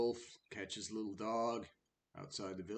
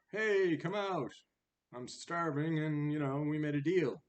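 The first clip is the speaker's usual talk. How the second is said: high, insistent mock call; wolf calling out; 7 semitones higher, 9 dB louder than usual. Hungry wolf calling the dog out, acted insistent call. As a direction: call out to someone indoors, pitched up.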